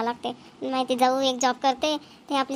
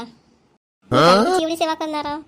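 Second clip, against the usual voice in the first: resentful retort; 3 semitones higher, 7 dB louder, slower than usual. Acted. Daughter-in-law grumbling alone.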